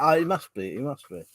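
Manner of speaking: usual speaking voice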